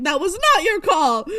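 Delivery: mock-angry telling-off, loud and high; imitated stern yelling. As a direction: scold like a boss, imitating his yell. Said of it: "Coulson is yelling some very nice things, but in a very mean tone".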